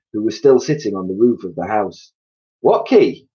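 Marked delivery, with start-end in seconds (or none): 2.6-3.3 high, loud shout. A narrator's reading. Roly-poly bird shouting down to Mugglewump. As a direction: call back from the roof. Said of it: "shouted the roly-poly bird".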